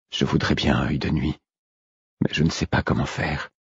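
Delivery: soft, faint inner voice; weak, quiet reply. A narrator's reading. Beaten man confessing to his wolf, faint and soft. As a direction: answer softly, with little strength.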